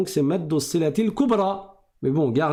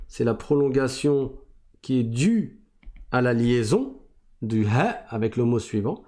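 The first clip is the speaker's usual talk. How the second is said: usual speaking voice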